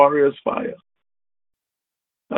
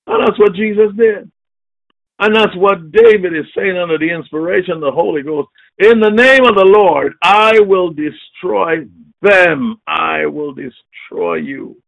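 preaching at full force; 4 semitones higher than usual. Acted. Preacher declaring victory over enemies, shouting. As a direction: shout it as a war cry.

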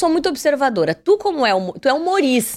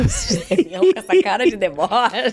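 laughing; 2 semitones lower than usual. Real, not acted. Laughing at host's mocking face.

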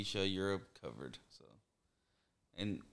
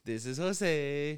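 put-on slow, loud, higher Spanish-accent intro; Spanish-accented introduction; 6 semitones higher, 4 dB louder, much slower than usual. Mimicking how people in Peru introduce themselves, slow Spanish accent. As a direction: draw out Jose the Spanish way. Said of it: "So they're like".

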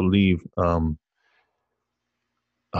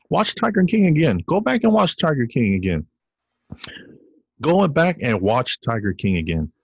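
insistent urging; 6 semitones higher than usual. Real, not acted.

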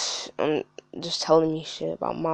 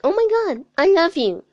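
high squealing mock fangirl, louder; gushing fan; 13 semitones higher, 10 dB louder than usual. Mocking his fans to the listener, high gushing squeal. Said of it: "they're like".

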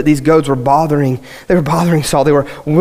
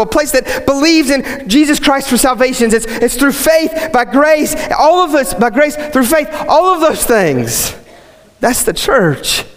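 high, driving preacher's cry; full-force preaching; 10 semitones higher than usual. Acted. Pastor rousing the congregation in full preaching voice. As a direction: preach it out to the whole room.

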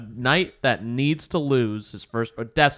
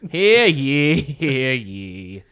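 town crier; 3 dB louder, much slower than usual. Acted. Jester calls out.

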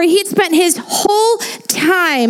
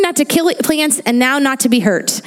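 usual speaking voice

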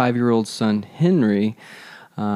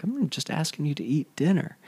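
softer, mock exasperated plea; exasperated parent; 6 semitones higher, 5 dB quieter than usual. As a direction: plead with a stubborn kid. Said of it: "I'm like".

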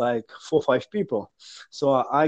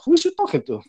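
surprised; 12 semitones higher, faster than usual. Retold or imitated. Confused reaction on the bus.

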